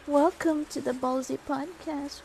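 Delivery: high, playful show welcome; high playful podcast welcome; high pet announcer voice. Real, not acted. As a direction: welcome listeners as if the dog speaks.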